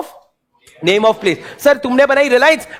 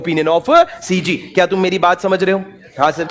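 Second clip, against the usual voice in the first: usual speaking voice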